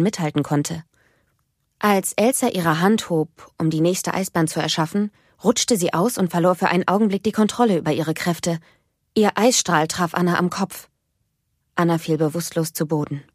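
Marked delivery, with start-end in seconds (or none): none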